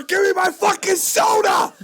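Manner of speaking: very high, loud, slow screamed demand; mock yell. A pretend angry customer at the counter, in a very high screamed demand.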